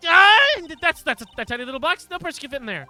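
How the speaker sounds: loud high startled cry. Acted. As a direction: jolt in surprise. Character startled exclamation.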